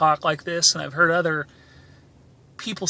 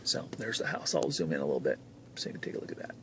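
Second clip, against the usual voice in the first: much softer and quick, nearly whispered; hushed aside; 13 semitones lower, 13 dB quieter, faster than usual. Real, not acted. Showing viewers the house, quick near-whisper. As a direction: breathe it out quickly as you zoom in. Aside while zooming the camera.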